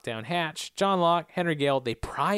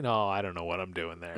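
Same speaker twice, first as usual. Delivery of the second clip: usual speaking voice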